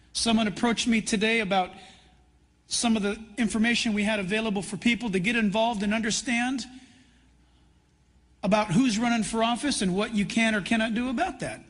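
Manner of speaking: ordinary talk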